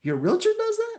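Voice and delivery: can't believe a realtor does that; amazed disbelief. Retold or imitated. Relatives amazed at Uncle Johnny's free trip, incredulous. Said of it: "They go".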